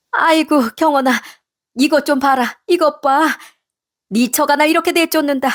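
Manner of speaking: high, plaintive mother-in-law playing the victim; feigned pitifulness. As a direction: put on a poor-me act for your son. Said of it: "표정을 바꿔 불쌍한 척 남편에게 얘기를 하더군요".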